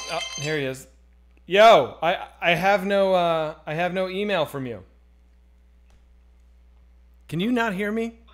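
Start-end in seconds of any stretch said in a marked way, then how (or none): 1.5-4.8 very loud into a bad connection; raised, insistent phone voice; calling out. Real, not acted. Shouting to caller on bad line. 7.3-8.2 loud, high question down the line; insistent shouted check on a bad connection; exasperated calling. Real, not acted. Caller still not responding.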